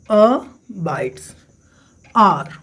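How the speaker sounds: "abides" split into a, pause, bides; syllable by syllable. Shown as a demonstration. Teacher counting sounds in a poem line, "abides" broken into syllables. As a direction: break "abides" into two separate beats. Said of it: "तो number of sounds count करिए".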